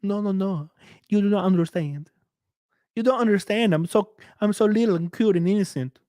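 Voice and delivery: high, cutesy whiny voice; mocking Zia. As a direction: play a fragile little kitten begging off. Mocking Zia refusing milk.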